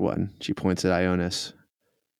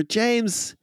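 James voice; 13 semitones higher than usual. Acted. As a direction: pitch way up, a small trapped thing. GM voices trapped thrall James.

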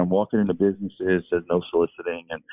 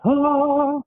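a sudden high, loud "ah" of relief; relieved ah; 5 dB louder than usual. Retold or imitated. Spotting a Burger King on a terrible day, a high loud relieved ah. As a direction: let out a high sigh of relief like a lifeline appeared.

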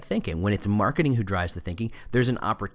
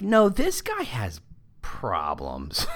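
exasperated retort; much slower than usual. Retold or imitated. Mildly exasperated reply to HR's handbook fix. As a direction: spell it out as if it's obvious.

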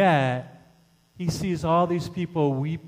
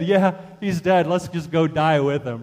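louder mocking disciple quote; mock bravado; 3 semitones higher, 7 dB louder than usual. Disciple proposing to die with Jesus, mock bravado. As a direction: toss it off in raised-voice mockery.

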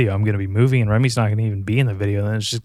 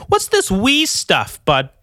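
high, slow, sarcastic jab at the commenter; sarcastic slow put-down; mocking retort; 7 semitones higher, slower than usual. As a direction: drag it out like calling someone's bluff.

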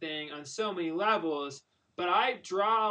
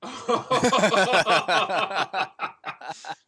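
hearty laugh; 5 dB louder than usual. Real, not acted. Laughs at own idea.

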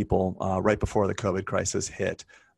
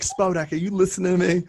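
high, louder mock scolding, up in his face; stern scolding friend; 11 semitones higher, 5 dB louder than usual. Friend imitating her scolding, high and louder. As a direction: playfully imitate her calling him out. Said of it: "you're like".

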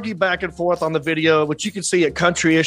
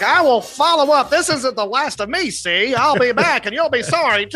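higher-pitched mock gangster threat; old-school gangster; 6 semitones higher than usual. Host plays Karen as a gangster, comic old-school menace. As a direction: threaten like an old-time movie mobster. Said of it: "just like an old school, uh, gangster".

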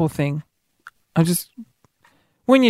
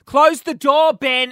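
high-pitched, exasperated order; exasperated telling-off; 7 semitones higher, slower than usual. Acted. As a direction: scold a colleague in mock outrage. Acted telling-off of colleague.

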